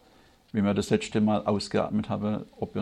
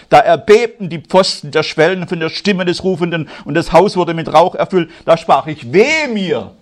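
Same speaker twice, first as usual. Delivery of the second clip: louder, higher fervent sermon; full-force preaching; 5 semitones higher, 9 dB louder than usual. Acted. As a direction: preach it with rising fire.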